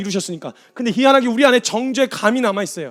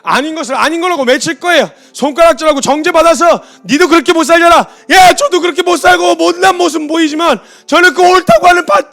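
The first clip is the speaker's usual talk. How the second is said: higher and much louder impassioned cry; preacher crying out to the church to grieve; full-force preaching; 8 semitones higher, 11 dB louder than usual. Acted. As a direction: shout the call to repent.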